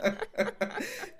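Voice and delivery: high, breathy burst of laughter. Real, not acted. Laughs at own punctuality joke.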